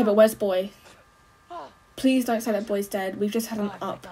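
ordinary talk

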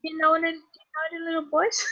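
high-pitched character voice; comic falsetto-like Herbert impression. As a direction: put on a high, creepy Herbert voice. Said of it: "with a very high-pitched voice".

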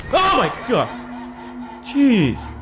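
high, startled outburst; real startled cry. Real, not acted. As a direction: jolt into a high yelp of alarm. Startled by something in game.

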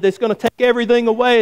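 high-pitched acted warning; mock-alarmed call; retold urgent yell. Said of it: "looks in, yells at him".

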